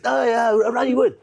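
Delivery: high, loud eager broken English; imitating excited lodger. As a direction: burst out with fan joy. Japanese lodger voiced in story.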